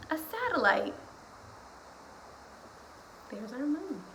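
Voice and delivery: put-on animated read-aloud of a kids' book; reading to children. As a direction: read it to kids with big bouncy energy. Reads picture book aloud.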